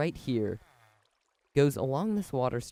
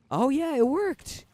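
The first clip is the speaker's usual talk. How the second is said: excited; 7 dB louder than usual. Real, not acted. Redstone machine finally works.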